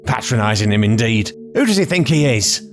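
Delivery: high, indignant ranting; furious old lady threatening a young man; spoiling for a fight. A narrator's reading. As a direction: bristle for a fight, pitch climbing. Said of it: "spoiling for a fight".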